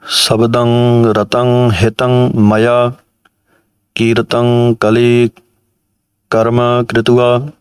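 slow, even scripture recitation; measured recitation. Shown as a demonstration. Teacher reciting gurbani for students, slow and measured. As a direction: recite each word deliberately.

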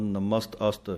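ਨਮਸਤ ਅਸਤ with a clear ਅ opening ਅਸਤ; ਨਮਸਤ ਅਸਤ, the ਅ given its own sound; with aira sound. Shown as a demonstration. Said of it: "ਐੜੇ ਦੀ ਆਵਾਜ਼ ਵੀ ਵਿੱਚ ਦਿੰਦੇ ਹਨ".

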